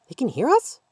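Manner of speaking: put-on high excited child's question; little girl blurting. A narrator's reading. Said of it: "the little girl blurted".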